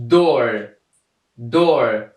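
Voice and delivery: "دور" begun with a clear D sound; careful teacher demo of "دور" with initial D; D as dal. Shown as a demonstration. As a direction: model "دور" as an example of the D sound. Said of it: "دی ما در اول آمده صدای دال داده".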